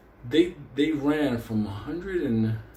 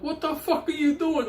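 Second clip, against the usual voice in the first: much higher, panicked hollering; frantic yell. Retold or imitated. Paranoid friend yells at teller through door, high panicked shout. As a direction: shriek it like a terrified guy.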